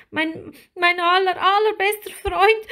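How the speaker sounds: high, loud tearful little mermaid; halting wail, breaking into sobs; sobbing. A narrator's reading. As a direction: cry it in a small, very high voice. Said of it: "schluchzte Lilli".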